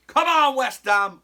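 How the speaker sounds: loud, high shout; mock cheer. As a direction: cheer like a fan in the stands. Cheering after singing.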